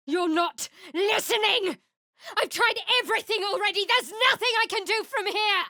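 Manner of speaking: high frantic yell; shout, desperate and unheard. Acted.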